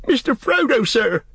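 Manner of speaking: high, frightened servant; quaking cry. A narrator's reading. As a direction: cry out for rescue, high-pitched. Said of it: "cried Sam, quaking".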